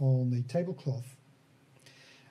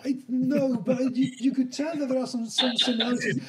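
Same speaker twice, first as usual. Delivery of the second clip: talking through laughter, pitched up; giggling while explaining; laughing; 8 semitones higher, 5 dB louder, faster than usual. Real, not acted. GM laughing at the players' joke. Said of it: "They might be related".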